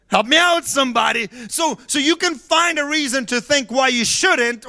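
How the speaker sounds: high, loud preaching shout; full-force preaching. Acted. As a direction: preach it out, building to a roar.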